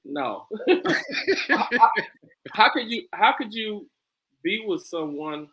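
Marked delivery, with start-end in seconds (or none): 0.5-1.4 high giggle. Real, not acted. Still laughing at the girl voice.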